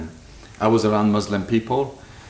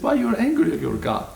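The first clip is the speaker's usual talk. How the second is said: higher-pitched quote of a teacher asking; quoting the teacher's question; 12 semitones higher than usual. Retold or imitated. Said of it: "She said".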